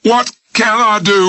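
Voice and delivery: very high, trembling cry; desperate vibrating. Acted. Old man at window cries out desperately to Smith. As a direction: quiver like a broken spring. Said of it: "vibrated like a broken bed spring".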